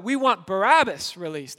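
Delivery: loud demanding cry; crowd shout. Retold or imitated. Crowd shouting its demand at Pilate, loud. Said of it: "So they shout out".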